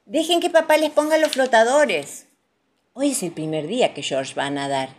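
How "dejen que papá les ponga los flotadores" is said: high, loud motherly instruction; raised, high-pitched mum voice; mother calling out. A narrator's reading. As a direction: call it across to the children. Mother calling to her children.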